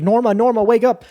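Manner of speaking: high, urgent call to rouse someone. Retold or imitated. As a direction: imitate a dad calling out to wake his daughter. Dad rouses his passed-out daughter.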